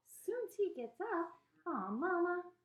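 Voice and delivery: slow, high Southern dismay; dismayed daughter in a Southern accent; upset, disappointed, Southern. A narrator's reading. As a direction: protest with a drawn-out, high wail. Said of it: "sounded real upset and disappointed and southern".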